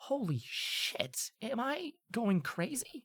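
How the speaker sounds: slow, hushed start, rising high on the question; stunned disbelief. Acted. Shaken student on seeing someone alive, slow hushed disbelief. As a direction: murmur it in disbelief, then pitch up. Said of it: "her hands shaking a little bit".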